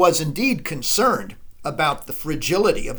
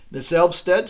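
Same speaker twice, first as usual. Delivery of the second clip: usual speaking voice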